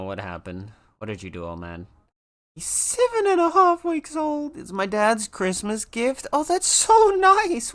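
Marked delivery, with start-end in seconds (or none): 2.6-7.7 high, loud cooing gush; very high excited coo. Real, not acted. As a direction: coo over the puppy news. Reading chat about puppy.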